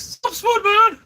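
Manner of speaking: a high, loud call across the street; builder's shout. Retold or imitated. Builder recognising him outside farm feeds, high loud yell. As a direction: shout his name like a builder spotting you. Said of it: "a builder or something goes".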